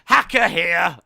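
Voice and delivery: loud, high, hammed-up Cockney; mock British accent. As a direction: bellow like a cartoon British geezer. Hams up Cockney hacker.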